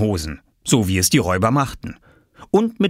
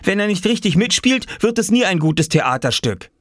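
put-on child crying out; 12 semitones higher than usual. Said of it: "rief Oskar".

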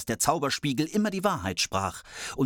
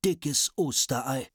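slow, high exclamation of wonder; drawn-out dwarf surprise; amazed dwarf; 4 semitones higher, slower than usual. Acted. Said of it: "riefen sie".

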